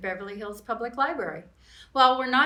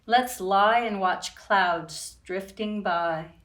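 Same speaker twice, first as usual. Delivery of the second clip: put-on reading to children. A narrator's reading. Reading picture book aloud.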